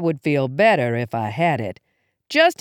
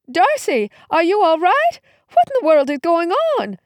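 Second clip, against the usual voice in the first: high, urgent motherly alarm; worried mom calling out in a high voice; 11 semitones higher, 3 dB louder than usual. Acted. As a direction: call out anxiously, pitch jumping high. Startled mother runs into the kitchen.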